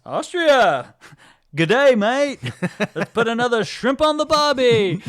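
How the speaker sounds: put-on a goofy, clueless Australian accent; mock Australian accent. Lloyd greeting the woman from Austria, in a mock Australian accent. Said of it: "confusing Austria with Australia".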